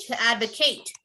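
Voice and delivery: To advocate as AD-vuh-KATE, for repeating; long A ending in advocate; full ATE ending. Shown as a demonstration. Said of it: "You would pronounce the A T E".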